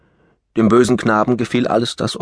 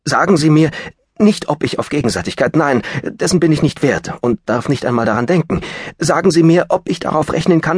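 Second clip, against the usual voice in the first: urgent pleading; 7 semitones higher than usual. Acted. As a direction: beg her urgently. Pleading for her answer.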